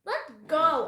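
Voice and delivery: put-on struggling child's loud demand; shouted protest. A narrator's reading. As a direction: shout it right into his face. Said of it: "Annie shouted into his face".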